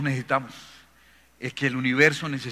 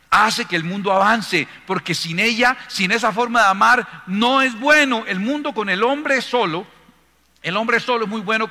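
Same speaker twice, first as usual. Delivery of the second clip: loud, raised preaching voice; emphatic pulpit exhortation, higher and louder; impassioned preaching; 5 semitones higher, 6 dB louder than usual. Acted. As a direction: drive each phrase home forcefully. Preacher rises to full force.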